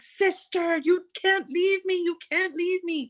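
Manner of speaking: very high-pitched imitated begging; hammed-up panicky wail; desperate pleading. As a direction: mimic a frantic companion pleading through a door. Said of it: "starts banging on the door".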